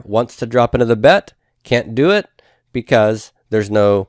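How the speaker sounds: ordinary talk, nothing marked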